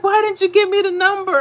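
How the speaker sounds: high, loud exasperated demand; imitating a frustrated husband catching the mistake; mock husband shouting; retold husband yelling. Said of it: "He's like".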